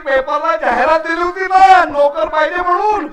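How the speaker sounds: excited high call to the wife; shouting. Acted. Husband calls wife about the newspaper advertisement, shouting. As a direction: holler the news through the house, rising to a yell. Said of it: "कशाला ओरडताय?".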